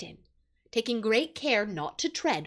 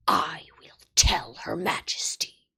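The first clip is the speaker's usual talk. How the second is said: menacing wolf whisper; 5 semitones lower, 3 dB louder than usual. A narrator's reading.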